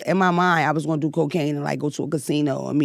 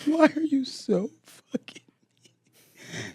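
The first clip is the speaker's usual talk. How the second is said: slow, high, softer mock sob; imitating sobbing; 8 semitones higher, 6 dB quieter, much slower than usual. Mother retelling sobbing at her mean teenager, slow high wail. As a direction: wail it like a crushed mom mid-cry. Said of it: "I sobbed".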